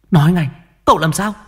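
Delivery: mother grabbing the servant, frantic and fast; urgent demand. A narrator's reading. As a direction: bark the order at the servant in panic. Mother demands news of son.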